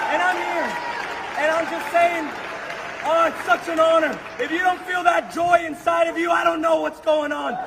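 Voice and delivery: rally the room like a revival preacher; preaching at full force. Real, not acted. Young man preaching to the room.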